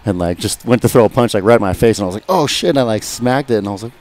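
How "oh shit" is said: startled yelp. Retold or imitated. Retold shock at a punch.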